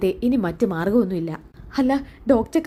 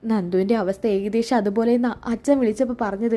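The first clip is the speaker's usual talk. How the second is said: ordinary delivery, nothing marked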